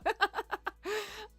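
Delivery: high, bashful giggle; a shy little giggle. Real, not acted. Thanking a new follower, giggling shyly.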